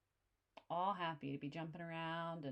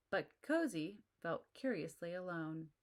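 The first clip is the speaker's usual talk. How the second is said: ordinary delivery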